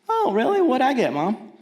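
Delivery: put-on high eager child asking mom; lightly voiced eager child; kid voice. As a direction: play the curious little kid.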